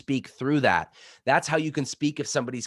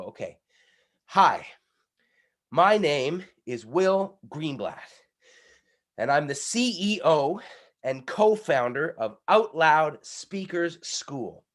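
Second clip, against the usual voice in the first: slow, punching each key word; boxing on key words; 4 semitones higher, slower than usual. Shown as a demonstration. Coach showing how to box on key words, punched. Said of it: "So you go".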